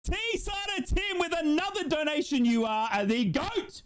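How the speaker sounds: drawn-out, high celebratory hail falling off; excited donor shout-out. Real, not acted. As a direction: hail the donor like a star, stretching it out. Hailing a donor on stream.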